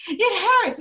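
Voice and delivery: high imitated whine; mock whining. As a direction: mimic the kid's whine, pitch up. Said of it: "I was like".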